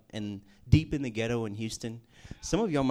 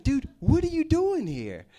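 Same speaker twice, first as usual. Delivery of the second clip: high, slow, delighted question; excited surprise; 13 semitones higher, 6 dB louder than usual. Retold or imitated. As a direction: greet an old acquaintance with glee. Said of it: "And he's like".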